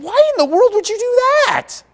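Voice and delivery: high, loud, disbelieving squeal; incredulous. Retold or imitated. Husband reacting to his wife's plan to burn grass, high incredulous. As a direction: shriek it in mock disbelief. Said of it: "And I said".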